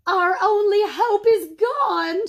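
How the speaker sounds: high, loud, slow wail of despair; mother wails over the lost cow; crying out in despair. A narrator's reading. Mother horrified at the beans, loud slow wail. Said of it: "she cried".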